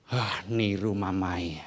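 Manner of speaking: put-on a kid sneering back, slowly; slow low mocking child. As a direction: drag it out low and slow, taunting. Child mocking, parent reacts.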